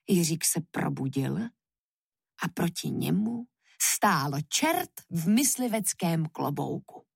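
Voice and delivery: ordinary delivery, nothing marked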